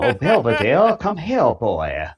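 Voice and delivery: mocking northerners faking a Southern accent. Author mocking bad auditions, in an overdone fake Southern accent. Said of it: "trying to do what they think a Southern accent sounds like".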